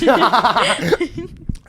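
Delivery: high squealing laugh. Real, not acted. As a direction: burst into a high giggle. Laughs after asking grace.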